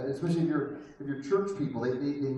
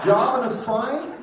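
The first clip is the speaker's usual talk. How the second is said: laughing disbelief; 5 semitones higher, 6 dB louder than usual. Real, not acted. Incredulous laughing repeat of punchline.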